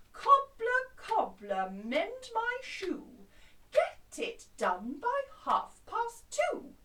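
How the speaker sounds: loud, high and slow demanding; bossy customer. Shown as a demonstration. Customer loudly ordering the cobbler, very bossy. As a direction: bark it at the cobbler. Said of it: "your bossy customer".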